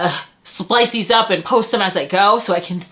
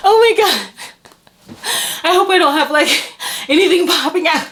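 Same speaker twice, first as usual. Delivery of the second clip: overwhelmed; 9 semitones higher, faster than usual. Real, not acted. Overwhelmed opening gifts.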